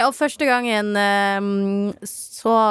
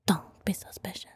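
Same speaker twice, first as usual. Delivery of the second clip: whisper; 12 dB quieter than usual. Retold or imitated. Sneaking home, acting innocent.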